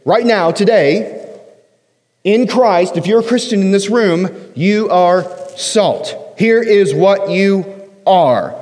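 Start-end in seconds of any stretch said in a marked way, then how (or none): 4.5-8.5 "you are salt" very slow, each word weighed; slow emphatic. Shown as a demonstration. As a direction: spell it out word by word. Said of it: "It's an indicative statement".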